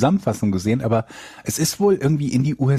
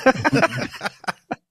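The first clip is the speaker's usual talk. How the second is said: high laugh; 13 semitones higher than usual. Real, not acted. Laughs at sneeze talk.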